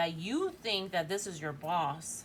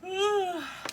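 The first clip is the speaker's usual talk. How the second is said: a long high yawn that slides down; sudden yawn; 12 semitones higher, 5 dB louder than usual. Real, not acted. Reader yawns suddenly during her tarot reading, high sliding yawn. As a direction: let a real yawn escape mid-reading. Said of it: "I don't know why I felt the need to yawn".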